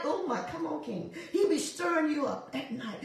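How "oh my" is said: ordinary talk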